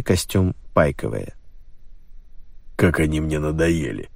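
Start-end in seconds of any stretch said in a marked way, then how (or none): 2.8-4.1 grumbling. A narrator's reading. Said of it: "проворчал полковник".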